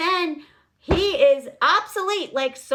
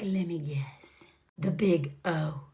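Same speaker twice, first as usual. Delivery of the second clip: low, slow and half-whispered; mock flirty murmur; 10 semitones lower, 6 dB quieter, slower than usual. Woman flirting with a grown man about his nickname, mock sultry. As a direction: tease it out slowly under the breath. Mocks her flirty guessing.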